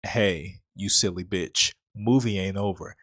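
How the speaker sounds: the movie talking back. Acted. Movie tells the hopeful viewer it isn't over, low taunt. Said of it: "it said".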